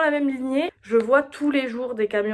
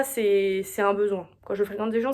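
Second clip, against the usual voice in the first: ordinary talk, nothing marked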